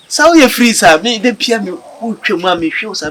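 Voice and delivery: high, loud excited telling. Acted. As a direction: gush about it. Excited account.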